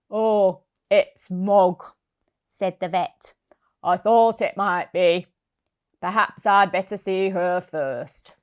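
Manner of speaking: a calm, confident vet; vet's voice. Acted.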